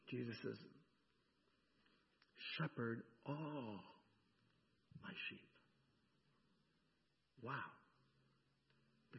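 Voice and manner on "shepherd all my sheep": soft commission. Acted. Jesus recommissions Peter.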